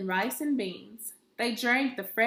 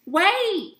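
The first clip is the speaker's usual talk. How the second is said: high, loud cry of wait; 8 semitones higher, 9 dB louder than usual. Acted. Grandmother cries out too late, high and loud. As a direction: stop them with a sudden cry. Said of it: "cried Rosa Maria".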